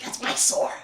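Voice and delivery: voiceless mock-menacing growl; threatening creature voice. [name] threatens Richard over the sword, threatening. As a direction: lightly imitate a creature guarding its treasure.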